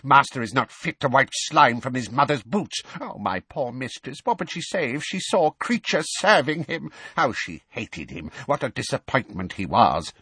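high, resentful elf tirade; seething elf, swinging from loud to plaintive; furious muttering. A narrator's reading. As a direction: grumble venomously to yourself. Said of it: "he muttered furiously".

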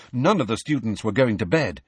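usual speaking voice

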